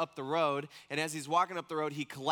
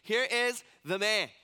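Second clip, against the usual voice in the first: slow, louder proud announcement; 5 semitones higher, 6 dB louder, slower than usual. Retold or imitated. Pilate proudly presenting Jesus to the crowd, slow proud call. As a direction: present him like a trophy. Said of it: "he's super proud with himself".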